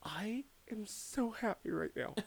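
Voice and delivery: slow unvoiced ecstatic whisper; cat sitting happily in tub water; blissful whisper. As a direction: breathe it out in pure bliss.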